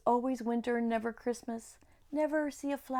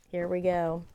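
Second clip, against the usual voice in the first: usual speaking voice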